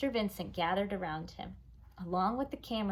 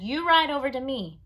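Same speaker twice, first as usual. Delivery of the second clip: cameraman call; 6 semitones higher, 8 dB louder than usual. Acted. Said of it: "The cameraman said".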